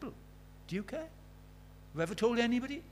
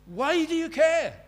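much higher, louder, slow wondering voice; the watchman asking in a high, slow voice; night watchman asking; 12 semitones higher, 10 dB louder, slower than usual. Retold or imitated. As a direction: stretch it out high like a puzzled man. Night watchman's question quoted.

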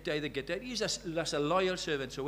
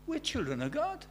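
high, wondering rise; astounded; 9 semitones higher, 3 dB quieter than usual. Acted. John realising he is God's child, astounded. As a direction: say it like you can't believe it. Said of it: "he is astounded".

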